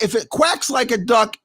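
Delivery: ordinary delivery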